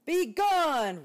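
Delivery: loud high roar. Acted. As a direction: roar him away. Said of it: "roared Bear".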